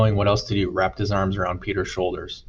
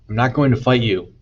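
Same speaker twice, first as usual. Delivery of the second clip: a louder, higher cry of refusal; shouted; 4 semitones higher, 5 dB louder than usual. A narrator's reading. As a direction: shout back the refusal while being kicked. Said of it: "Cole shouted".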